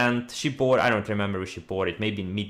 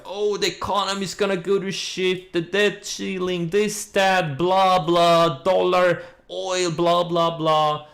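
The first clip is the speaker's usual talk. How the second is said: higher, louder doomsayer whine, droned on one note; mock whining; 6 semitones higher, 3 dB louder, slower than usual. Trader mocking the bears' crash talk, whiny. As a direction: imitate a whiny naysayer rattling off complaints. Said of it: "the whiny camp, like".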